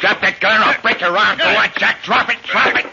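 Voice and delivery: angry threat. Acted. Pete ordering Jack to drop the gun, angry shout. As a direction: bark the order, rising as he repeats it. Gunman turns tables on partner.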